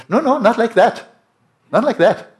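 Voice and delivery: high, loud emphatic protest in a mimicked voice; imitating His Holiness. His Holiness cutting off the interpreter's claim, high loud mimicry. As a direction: mimic the teacher cutting in with a firm no. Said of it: "That was a pretty good imitation".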